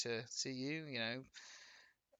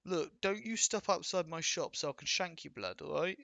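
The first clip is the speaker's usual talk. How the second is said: mock street London slang, a touch louder; London roadman accent; 11 dB louder than usual. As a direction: lightly ham up a London roadman threatening. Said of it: "people go".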